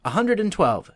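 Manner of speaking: put-on girl inventing a number to frighten the bandits; exclamation. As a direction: blurt the number out in feigned alarm. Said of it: "exclaimed Martha".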